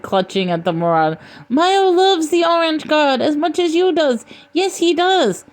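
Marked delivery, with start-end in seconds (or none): 1.5-5.4 high-pitched fawning creature; Milo. A narrator's reading. Creature pleading with the marauder, high wheedling voice. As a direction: insist on devotion in a high put-on voice.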